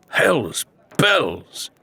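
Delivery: lightly voiced shipwright, a touch unsteady; dizzy shipwright exclaiming. A narrator's reading. Shipwright reeling from the cursed box, mild startled oath. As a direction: blurt it out while reeling. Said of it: "he uttered, stumbling this way and that".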